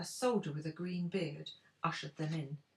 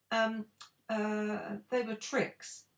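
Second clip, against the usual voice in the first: sheepish; 5 semitones higher, 5 dB louder, much slower than usual. A narrator's reading. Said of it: "Oz said sheepishly".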